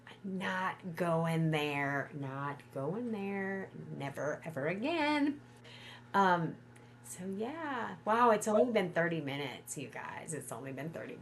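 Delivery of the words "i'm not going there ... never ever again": slow refusal. Real, not acted. Refusing to hire again.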